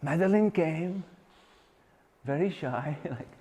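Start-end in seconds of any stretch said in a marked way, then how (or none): none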